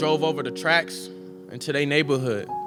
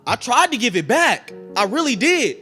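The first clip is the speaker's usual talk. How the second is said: pleading protest; 10 semitones higher than usual. Acted. Teen swearing he refused the gun, pleading protest. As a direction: insist on it, pitched up and pushed.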